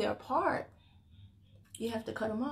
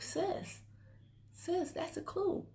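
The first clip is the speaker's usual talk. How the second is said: knowing aside; 8 semitones higher, 9 dB quieter, much slower than usual. Acted. Pointed advice to a viewer.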